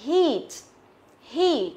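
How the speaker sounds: "heat" with long tense ee, falling; careful model of "heat", long i; long vowel demo. Shown as a demonstration. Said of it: "chúng ta sẽ đọc nó là âm Y dài".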